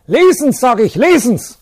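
high, loud enraged shouting; furious demand. Acted. Priest forcing the teacher to read, enraged shouting. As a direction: hammer the order home in fury.

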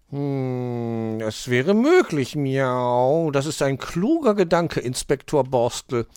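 slow, drawn-out cat voice with miaows; pondering tomcat detective. A narrator's reading. Mauz praises the hedgehog's clever idea, approving.

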